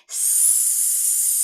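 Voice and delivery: long hissing s through the teeth; drawn-out s like a snake. Shown as a demonstration. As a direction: hiss the S like a snake. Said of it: "slither back and forth like a snake".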